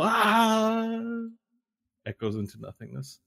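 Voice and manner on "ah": long high falling-man yell at moderate volume; acted distant high cry; fading yell. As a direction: draw out a high yell as you plummet. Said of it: "And his, his yell".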